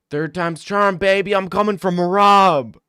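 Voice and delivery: loud, high hyped mic callout; Sean O'Malley callout. Retold or imitated. Fighter calling out the champ on the mic, loud and high. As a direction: call him out like a fighter on the mic. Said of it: "gets on the mic and says".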